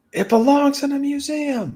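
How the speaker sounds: high put-on movie voice; Indiana Jones impression. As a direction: quote it like Indy. Quoting Indiana Jones movie line.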